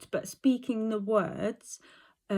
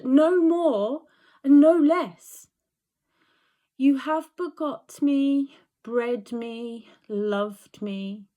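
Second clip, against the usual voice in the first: projected Cordelia speech, mostly higher and louder; declaimed classical verse, slowing on key phrases; Shakespeare monologue; 5 semitones higher, 6 dB louder than usual. Shown as a demonstration. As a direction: deliver it as a stage soliloquy to the king. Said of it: "I'll give the lines a run through now".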